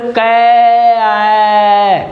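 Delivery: "कै, ऐ" with the vowel held very long; drawn-out vowel. Shown as a demonstration. Teacher showing the one vowel sound, "कै" stretched out. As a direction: stretch the ऐ in "कै" on one steady note. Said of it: "जैसे आप जो बोले हो".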